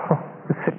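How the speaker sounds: normal talking voice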